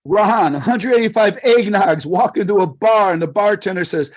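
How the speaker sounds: usual speaking voice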